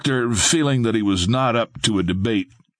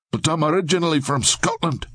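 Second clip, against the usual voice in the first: fast, higher Scottish blurt; 7 semitones higher, faster than usual. A narrator's reading. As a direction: blurt it out quickly. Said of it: "Lincoln blurted out".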